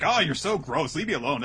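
high, loud huffy tsundere. Retold or imitated. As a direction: snap it like a flustered anime girl. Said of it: "pulls the full tsundere on him".

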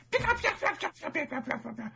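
very high, staccato mock villain laugh; silly falsetto cackle on "pika"; Pika's lame laugh. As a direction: squeak out a fading chattering laugh. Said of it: "Was that the laugh?".

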